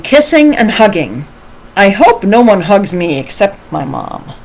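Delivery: animated read-aloud, lifting with the drama; story reading to children. A narrator's reading. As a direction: read a picture book expressively to children. Reads a picture book aloud.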